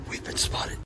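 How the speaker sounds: hushed wildlife narrator whisper. Pretend. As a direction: whisper as if hiding from animals. Whispered documentary aside.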